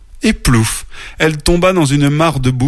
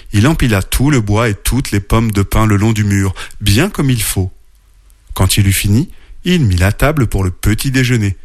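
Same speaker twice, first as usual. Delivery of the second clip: plain reading voice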